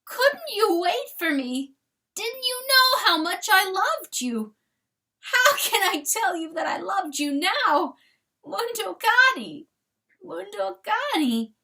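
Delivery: high whine rising each time; childlike whine. A narrator's reading. Chanticleer whining for his dead dog like a child, very high. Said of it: "whined high in his throat, a child".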